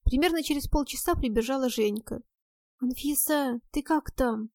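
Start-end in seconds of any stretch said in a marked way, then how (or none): none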